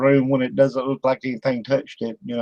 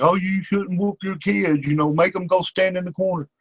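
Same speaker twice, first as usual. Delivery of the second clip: high, softened voice of a disapproving busybody; mock scoffer; 6 semitones higher, 3 dB quieter than usual. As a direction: mimic the scoffers in a higher, put-on voice. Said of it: "the ones that all scoff about it".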